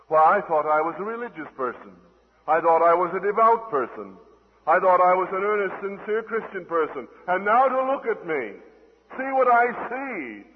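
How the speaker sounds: loud, high cry of dismay; horrified outcry. Acted. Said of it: "draw back and horror and say".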